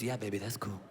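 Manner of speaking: very low, soft, lazy stoner voice; stoned dad. Retold or imitated.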